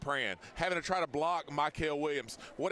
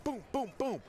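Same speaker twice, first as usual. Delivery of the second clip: high, punchy repeated booms; excited boom; 9 semitones higher than usual. Retold or imitated. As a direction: fire off each boom. Mimicking repeated scores.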